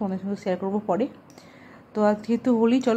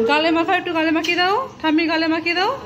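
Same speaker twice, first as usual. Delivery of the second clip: calling out; 8 semitones higher, 5 dB louder than usual. Real, not acted. Mother calling to kids playing.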